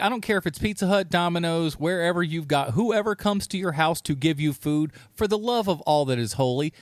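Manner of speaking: usual speaking voice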